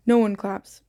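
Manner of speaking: plain reading voice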